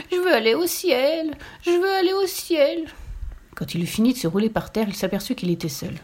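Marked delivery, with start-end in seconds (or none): 0.1-2.9 high, slow wailing of want; sobbing piglet. A narrator's reading. The piglet rolling on the floor, wailing high and slow. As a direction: wail it like a sulking piglet. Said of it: "en sanglotant".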